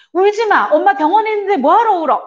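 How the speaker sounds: high, loud, fast scolding; scolding parent. Retold or imitated. Parent scolding a crying child, impatient and loud. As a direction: snap at the child to stop crying.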